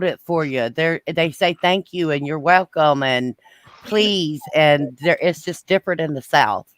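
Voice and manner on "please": ordinary delivery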